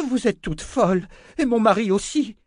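high, loud outraged cry. Acted. Amy crying out at the sisters, high and loud. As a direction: shriek it at them, building. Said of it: "s'écria Amy".

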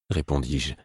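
usual speaking voice

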